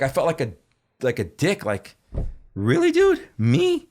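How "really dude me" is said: incredulous. Retold or imitated. Incredulous retort in retold scene.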